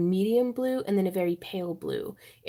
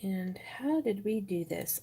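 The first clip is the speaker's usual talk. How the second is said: soft unvoiced whisper; whispering to herself; 5 dB quieter, slower than usual. Real, not acted. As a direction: murmur it while concentrating. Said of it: "I'm gonna have to be quiet".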